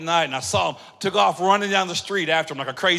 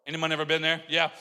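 normal talking voice

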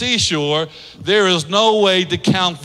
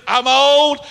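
Sarah admitting age; 6 semitones higher than usual. Acted. Sarah frankly tells the prophet she is old, raised voice. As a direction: say it like an old woman owning it. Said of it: "She just admits it".

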